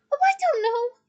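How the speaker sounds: high, loud, biting sarcasm; yelled sarcasm. A narrator's reading. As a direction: yell it with awful sarcasm. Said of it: "yelled Hermione with awful sarcasm".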